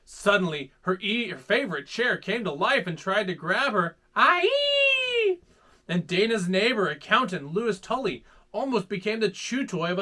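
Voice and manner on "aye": high startled shriek. Acted. Chair grabs Dana.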